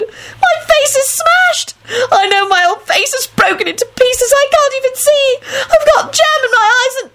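very high, tearful whine; moaning wail. A narrator's reading. Rosie face first on the floor, wailing in pain. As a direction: wail as if badly hurt, drawn out. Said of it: "Rosie moaned".

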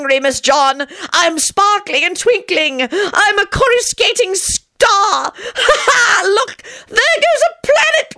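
very high, slow, giddy near-shrieking; giddy euphoria. A narrator's reading. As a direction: float away in mad delight. John laughing, soaring.

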